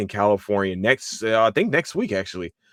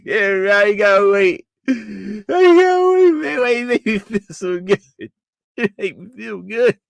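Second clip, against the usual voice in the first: overwhelmed fan wailing; 12 semitones higher, 6 dB louder than usual. Pretend. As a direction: whine and howl as if it's unbearable to wait. Overcome by impatient excitement, apologises after.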